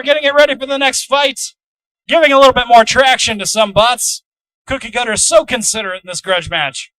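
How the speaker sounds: excited, loud fight commentary; live play-by-play. Acted. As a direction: call the action as it happens.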